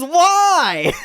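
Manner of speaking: very high, loud comic wail; mock anguished yell. Punk guy kicked for no reason, loud mock wail. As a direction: imitate the victim crying out in protest. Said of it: "just because he's angry".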